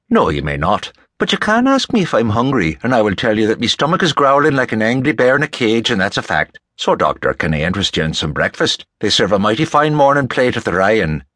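folksy Irishman, lively and teasing; jovial Rafferty. Acted. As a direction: rattle on like a hungry, jovial Irishman. Voices Rafferty inviting Watson.

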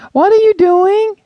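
high, loud call from up on the deck. Acted. Sister calling down from the deck, loud. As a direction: call down to her brother from far away. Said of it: "Jesse called from high up".

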